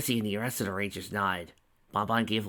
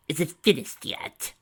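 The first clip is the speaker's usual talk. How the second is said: impatient; 7 semitones higher, 3 dB louder than usual. A narrator's reading. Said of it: "Tracy asked impatiently".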